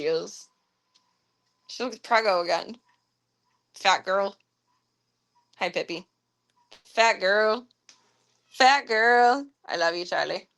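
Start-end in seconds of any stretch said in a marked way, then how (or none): none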